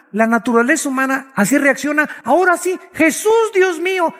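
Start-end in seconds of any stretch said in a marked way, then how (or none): 2.2-4.1 high, desperate cry of someone suddenly praying; mock panicked plea. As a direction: play a frightened person begging God in a panic. People panicking in a disaster.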